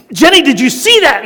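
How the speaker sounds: high, loud, thrilled call to his wife; husband calls to Jenny after the trick, high and loud; excited boast. Retold or imitated. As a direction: shout it like you finally landed the trick. Proud of a rope trick.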